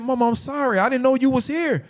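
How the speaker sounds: high-pitched excuse to mom; imitated high, apologetic protest; retold pleading apology. Retold apology to angry mother.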